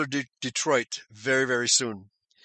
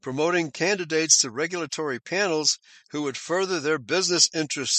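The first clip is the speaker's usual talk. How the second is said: normal talking voice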